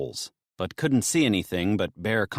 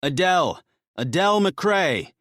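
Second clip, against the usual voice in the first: raised voice, calling higher; urgent calling out; louder call; 7 semitones higher, 3 dB louder than usual. Acted. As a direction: call her full name louder through the gap. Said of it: "louder this time".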